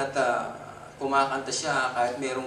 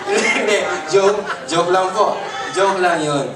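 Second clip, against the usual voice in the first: laughing; 3 semitones higher, 8 dB louder than usual. Real, not acted. Laughing, retracting teasing.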